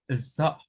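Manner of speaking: British stop; stop demo. Shown as a demonstration. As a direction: stop said clean, no R. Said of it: "No suena la R".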